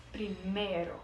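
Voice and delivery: ordinary delivery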